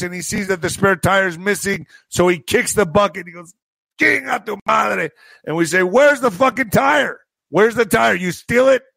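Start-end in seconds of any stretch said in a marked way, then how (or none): 4.0-5.1 acted cursing. Man curses, kicks the bucket. 5.8-8.8 high, heated demand; angry confrontation. Retold or imitated. Acted confrontation over stolen tire.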